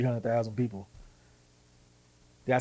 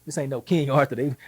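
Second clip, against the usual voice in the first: normal talking voice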